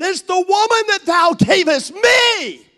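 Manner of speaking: high, loud whiny accusation; Adam blaming God for the woman, shouting high; Adam shouting blame. Retold or imitated. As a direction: shove the blame onto the woman. Voicing Adam blaming Eve.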